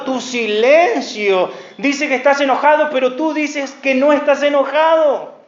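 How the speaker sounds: forceful sermon rebuke; preaching at full force. Acted. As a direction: press the point on the listener. Preaching confrontation.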